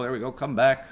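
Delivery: ordinary talk, nothing marked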